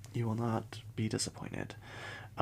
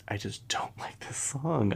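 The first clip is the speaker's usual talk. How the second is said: guilty whisper; 4 semitones higher, slower than usual. Real, not acted. Admitting dislike of a song.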